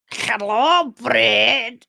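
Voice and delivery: mock drawl on "Hello, Britt", vowels stretched odd; imitating friend's husband. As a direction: tease it like his voice. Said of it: "husband talks like that".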